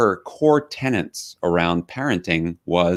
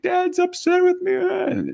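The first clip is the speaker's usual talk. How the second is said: imitating a sobbing child; slower than usual. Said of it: "he's like".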